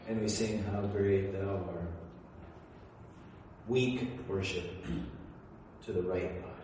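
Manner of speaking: low and limp to show weak worship; deflated hush. Real, not acted. Preacher mocking a sleepy congregation, softly deflated.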